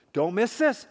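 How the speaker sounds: ordinary talk, nothing marked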